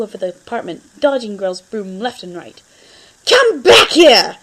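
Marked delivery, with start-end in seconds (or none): 3.3-4.4 high, loud, slow angry shout; furious yell. Acted. Grell chasing the cat with a broom, furious yell. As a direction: bellow at the fleeing cat. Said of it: "The veins in his forehead bulged".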